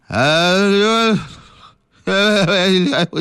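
halting frightened uh uh uh; tongue-tied with fright, stalling; stammering in fear. Acted. 何捕头炸着胆子问话，吓得结巴. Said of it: "舌头都抽筋儿了，直哆嗦啊".

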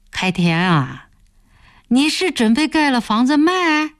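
puzzled, rising high on the question; baffled, pitch lifting after the name. A narrator's reading. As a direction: ask as if you cannot make sense of it. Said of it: "不解地问开田".

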